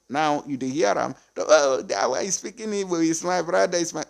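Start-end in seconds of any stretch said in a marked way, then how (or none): 1.4-4.0 naive believer voice. Retold or imitated.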